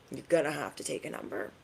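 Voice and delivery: soft, quick aside; quiet dismissal. Acted. Reader turning away the haters, quiet dismissal. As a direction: say it low in volume, brushing them off. Repeats dismissal under the breath.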